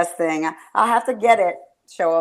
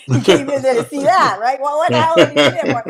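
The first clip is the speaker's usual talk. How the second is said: quote through laughter; 5 semitones higher than usual. Retold or imitated. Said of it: "he said".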